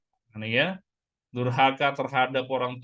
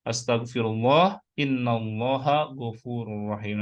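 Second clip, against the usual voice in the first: measured recitation with long-held syllables; slow model recitation; 2 semitones lower, slower than usual. Shown as a demonstration. Preacher gives the istighfar to recite after maghrib, slow recitation. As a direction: stretch each word out as a model. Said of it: "Nanti boleh di-sharing ya".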